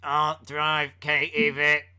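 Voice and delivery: deadpan robotic Kane, a bit hammy. Retold or imitated. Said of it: "Was it with the robot voice?".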